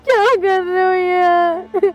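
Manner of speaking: slow, drawn-out tearful wail; crying protest. Acted. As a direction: wail it helplessly through tears. Daughter weeps at departing mother.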